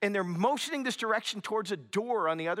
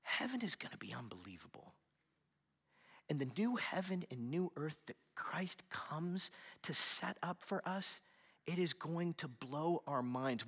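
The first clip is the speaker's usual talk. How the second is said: awed whisper; 2 semitones lower, 10 dB quieter than usual. Real, not acted. Preacher to congregation about heaven, hushed awed whisper. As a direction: lean in and whisper the awe. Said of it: "intense hushed promise".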